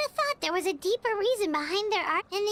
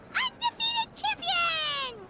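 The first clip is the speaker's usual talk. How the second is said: mock grand title; 8 semitones higher, much slower than usual. Teasing the hero with a grand title.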